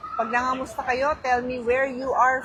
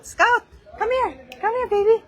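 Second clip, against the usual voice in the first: loud high-pitched dog call; calling a pet; 13 semitones higher, 8 dB louder than usual. Real, not acted. Owner summoning Scout to meet viewers, loud high call.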